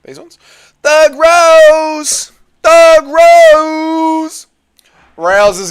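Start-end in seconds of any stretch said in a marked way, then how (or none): 0.8-2.3 high, loud ring-announcer yell; hype shout. Real, not acted. Hype call for fighter. 2.6-4.5 high, loud ring-announcer yell; hype shout. Real, not acted. Hype call for fighter.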